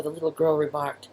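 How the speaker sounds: plain reading voice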